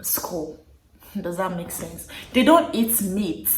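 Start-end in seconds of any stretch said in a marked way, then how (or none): none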